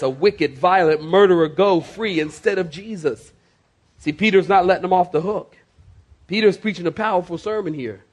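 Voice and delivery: usual speaking voice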